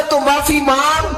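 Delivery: high ringing command. Acted. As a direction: proclaim the Lord's command, pitched high. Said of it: "رب کہتا ہے".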